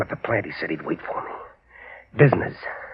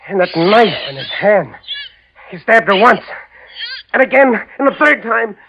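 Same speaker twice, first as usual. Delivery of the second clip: much higher and louder, building in panic; frantic rising agitation; 9 semitones higher, 3 dB louder than usual. Acted. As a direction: spiral into hysteria. Prisoner relives wife's murder.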